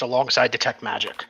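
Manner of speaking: usual speaking voice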